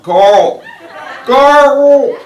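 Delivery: mock yell, high and loud; loud hammed-up imitation of calling out. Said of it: "to start yelling out".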